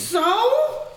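high, loud, drawn-out "so?"; defiant. Acted. Character brushing off a warning about a demon arm, defiant. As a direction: toss back a loud, high challenge.

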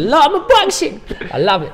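high, loud put-on voice; Steve Banks impression. Echoing a mate's catchphrase in a high, loud mock voice. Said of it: "Steve Banks".

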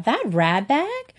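sneering, slow on ratbag, then shooting up high; scornful girl. Acted. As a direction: spit out ratbag with disdain. Evelyn sneers at Cornelia.

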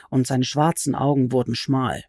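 usual speaking voice

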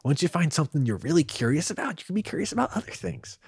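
high, loud wonder; excited. Real, not acted. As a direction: gush about it in awe. Rapt, rising enthusiasm.

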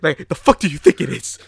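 mock outrage through laughter; laughing exasperated. Real, not acted.

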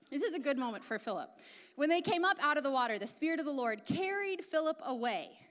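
ordinary talk, nothing marked

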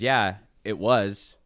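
slow, drawn-out, a touch of regret; wistful mom. Retold or imitated. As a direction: let mom linger on the memory. Voicing mom remembering her dream.

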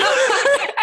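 bursting high laugh. Real, not acted. Telling a friend about dad, real high laugh.

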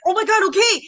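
flustered panic. Retold or imitated. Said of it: "And I was like".

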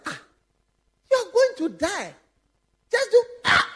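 high, frightened cries of a soldier under fire; mock panicked soldier. Soldier in the film, squealing in mock fear. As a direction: act out a terrified fighter. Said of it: "I say".

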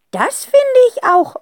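put-on high, loud, slow old aunt voice. A narrator's reading. Aunt answering the phone, amused. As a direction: stretch it out like a cheeky old lady. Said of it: "antwortet eine Stimme".

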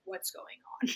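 unvoiced, lost whisper; whispered confusion in the crowd; confused whisper. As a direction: breathe it out in a daze. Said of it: "I was just like".

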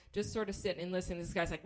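ordinary delivery

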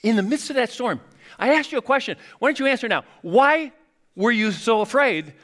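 Jesus looking back on the storm, high and insistent; Jesus asking later. Retold or imitated. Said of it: "he would go back".

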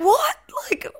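high, sharp disbelieving what; shocked what. Retold or imitated. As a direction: gasp it out in shock. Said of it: "I was like".

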